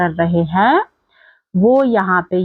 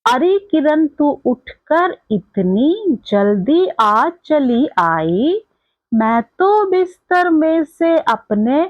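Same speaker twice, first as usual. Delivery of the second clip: slow, higher-pitched singsong recital; poem recitation; 4 semitones higher, slower than usual. Acted. Teacher reciting a poem to young pupils, slow lilting recitation. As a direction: recite it slowly in drawn-out, lilting lines.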